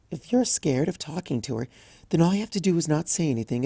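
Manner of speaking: hushed and hesitant timid mare; timid Fluttershy. Acted. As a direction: speak softly like a bashful pony. Fluttershy explains her shy habit.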